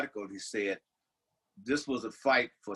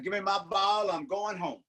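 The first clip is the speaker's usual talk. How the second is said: put-on higher-pitched sulking child, in jest; mock crybaby; 8 semitones higher than usual. Pundit joining co-host's crybaby joke, mock sulk. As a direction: play the kid who quits in a huff. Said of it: "That's a crybaby".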